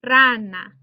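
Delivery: "rana" with a loud, punched first syllable; stress on first syllable. Shown as a demonstration. Teacher demonstrating the word for frog, first syllable of "rana" stressed. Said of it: "you can hear the verbal stress falls".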